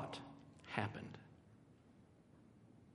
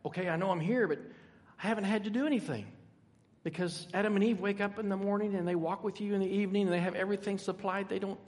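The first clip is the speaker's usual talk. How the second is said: Hope; 5 semitones higher, 3 dB louder than usual. Acted. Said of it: "Hope's like".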